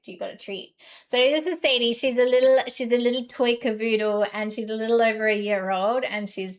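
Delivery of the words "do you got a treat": hushed dog voice. Retold or imitated. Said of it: "she's like".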